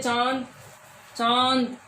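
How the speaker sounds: चांद with a nasal aa through nose and throat; nasalized vowel. Shown as a demonstration. Teacher has pupils try a nasalized vowel. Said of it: "तुम खुद भी बोल के देखोगे".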